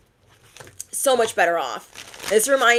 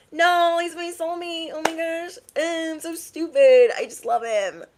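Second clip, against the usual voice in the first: hammed-up lovesick gushing in a high voice; mock lovestruck; 8 semitones higher than usual. Mocking her past self blindly in love with her ex, mock lovestruck. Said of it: "I can't even say it with a straight face".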